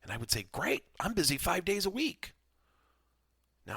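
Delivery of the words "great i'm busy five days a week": high-pitched, cheery retold answer; upbeat reply. Consultant answering somber clients about business, upbeat. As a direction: toss back a bright, higher-pitched retort.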